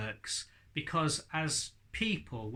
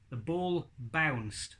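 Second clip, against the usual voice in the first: slow demo sentence; much slower than usual. Shown as a demonstration. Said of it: "let's think of a word".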